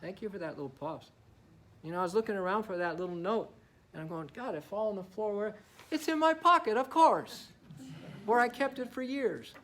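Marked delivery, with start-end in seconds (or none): 4.3-9.5 high, loud flustered self; flustered self-quote. Retold or imitated. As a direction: play your own frantic searching. Retelling searching for the note.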